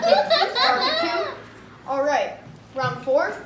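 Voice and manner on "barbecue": high, loud, slow and laughing; repeating louder and sillier. Acted. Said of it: "Say it louder".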